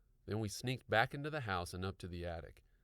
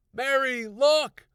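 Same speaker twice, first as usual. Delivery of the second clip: very loud high excited shout; 15 dB louder, much slower than usual. A narrator's reading. Husband shouting to his wife, excited shout. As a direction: yell for her to look. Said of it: "shouted excitedly".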